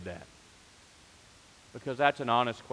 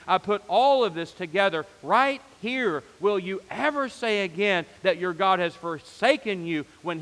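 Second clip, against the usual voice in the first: higher, louder, building preacher's cry; impassioned preaching; 5 semitones higher, 4 dB louder than usual. Acted. Pastor voicing God's promise to the church, impassioned. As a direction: preach it with rising fire.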